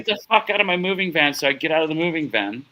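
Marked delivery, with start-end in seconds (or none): 0.0-1.3 quick, high retold eviction; irate order rattled off fast; angry order. Repeats van owner's order.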